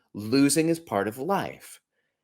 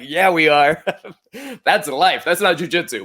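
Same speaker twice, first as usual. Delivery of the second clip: laughing; 7 semitones higher, 7 dB louder than usual. Real, not acted. Laughing at son's remark.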